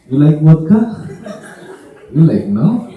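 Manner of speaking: loud mock Russian question, rising; Russian accent. Russian offers the guest vodka, in Russian accent. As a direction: offer it loudly in hammed-up Russian English. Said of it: "here in Russia".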